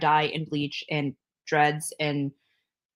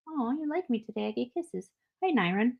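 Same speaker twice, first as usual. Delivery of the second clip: soft, higher-pitched little greeting; affectionate cooing; 4 semitones higher, 7 dB quieter than usual. Real, not acted. Greeting Nyron who gives kisses, soft affectionate cooing. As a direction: coo softly to a loved one.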